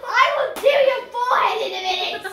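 laughing through the words; giggling while talking. Real, not acted. Laughing after teasing friend.

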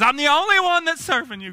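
high, loud self-pitying plea; put-on aggrieved prayer; mock whining complaint. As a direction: whine it up to God, hammed up. Acting out Elijah's prayer complaint.

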